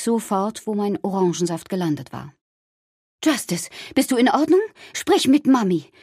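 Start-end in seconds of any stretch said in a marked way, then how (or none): 3.2-5.9 high, louder panicky calling; alarmed mother's cry. A narrator's reading. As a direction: cry out to your child in alarm. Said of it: "entrüstete Schreie hörte". (aufgeregtes, hohes Rufen einer Mutter)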